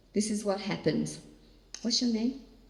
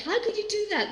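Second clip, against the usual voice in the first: retold anger at God; faster than usual. Mother to God about her son, pained protest. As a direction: pitch leaps up in hurt protest. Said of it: "Uh, I got angry".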